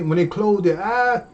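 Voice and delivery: ordinary delivery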